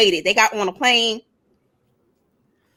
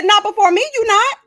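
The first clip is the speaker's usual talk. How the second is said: much higher, louder "Not before me, you not"; high sassy retort; 11 semitones higher, 3 dB louder than usual. Retold or imitated. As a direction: put her in her place, pitched way up. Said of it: "I said".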